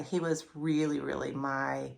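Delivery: holding back tears, understated; a touch of tears. Real, not acted. To viewers, remembering her late boxer, softly tearful. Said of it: "I get emotional, I wanna cry".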